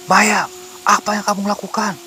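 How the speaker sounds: high-pitched, urgent cry; acted yell of alarm; alarmed shout. A narrator's reading. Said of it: "teriak Agung".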